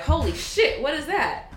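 high, loud startled gasp of a question; shocked exclaim. Retold or imitated. Strangers on the street gawking at her, high and startled. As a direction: blurt it out like you've seen something unreal.

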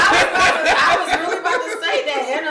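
loud, slow, laughing through words. Real, not acted. Laughing while talking.

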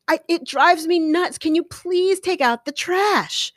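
naggy complaining. Shown as a demonstration. Wife scolding husband Bob over the trash, high naggy whine. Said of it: "comes across as sort of naggy".

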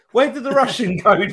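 high, loud, laughing disbelief. Real, not acted. Co-host ridiculing the vanished Russian accent, laughing.

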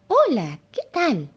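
bright high giraffe greeting; young giraffe voice. Acted. Giraffe greeting the monkey, voiced high and friendly.

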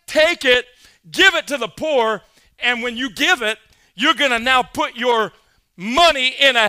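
loud, high, driving preacher's cry; full-force preaching. Acted. Preacher to his congregation on the law, loud and soaring. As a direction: pound it home to the congregation.

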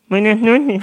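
lightly voiced radio caller. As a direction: greet the host shyly. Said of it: "están locutores". (oyente que llama, leve)

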